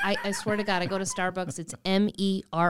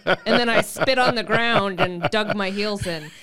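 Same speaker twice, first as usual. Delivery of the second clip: laughing, lightly Irish leprechaun lilt; 5 dB louder than usual. Acted. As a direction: say it like a cheeky leprechaun. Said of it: "You said that like a leprechaun".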